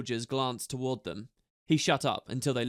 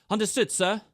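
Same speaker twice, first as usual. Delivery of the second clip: higher, crisp soldier's reply; to attention; 7 semitones higher, 4 dB louder than usual. A narrator's reading. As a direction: snap to attention. Ember answering commander.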